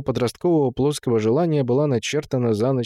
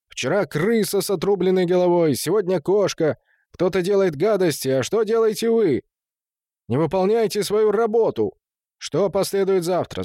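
high-pitched accusing tirade; indignant rebuke; 7 semitones higher than usual. A narrator's reading. Gustad blames watchman for dead animals, indignant. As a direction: take the idle watchman to task.

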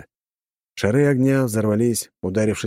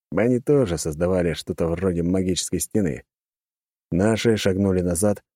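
usual speaking voice

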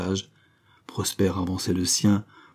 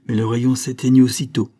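plain reading voice

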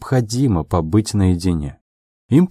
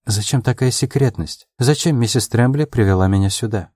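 ordinary delivery

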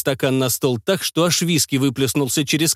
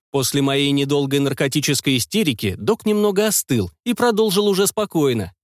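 ordinary delivery

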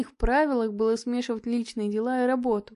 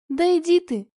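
higher, louder angry brush-off; angry outburst; 5 semitones higher, 5 dB louder than usual. Acted. Nanny tells Marina to get lost, angry outburst. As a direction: fling it out, pitched up in irritation.

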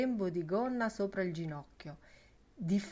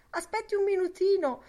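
high, fast, louder plea; pleading Lenihan; 13 semitones higher, 7 dB louder, faster than usual. Acted. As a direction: beg him to wait, in a hurry. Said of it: "supplicò Lenihan bevendo in fretta".

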